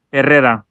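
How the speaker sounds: Herrera with the double R rolled; rolled R's. Shown as a demonstration. Retrying Dan's last name for the co-host, Herrera with rolled R's. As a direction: trill the middle of Herrera. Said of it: "let's start again".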